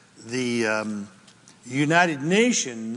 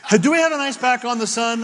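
jokey aside; 6 semitones higher, 3 dB louder, much faster than usual. Real, not acted. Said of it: "Solar ice pack".

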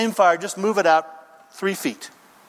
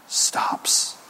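hushed, voiceless final word; whispered; 4 dB quieter than usual. Real, not acted. Preacher on torment that never ends, whispered. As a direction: drop to a whisper. Hushed end of repeated "ever.

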